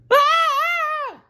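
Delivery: shrill high scream; scream together. A narrator's reading. Both in big trouble, shrill scream. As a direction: scream in panic. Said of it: "they screamed together".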